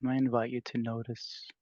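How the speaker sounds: unhurried, spacious meditation voice with long pauses; guided meditation. Real, not acted. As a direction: gently guide the group into stillness. Leads a meditation.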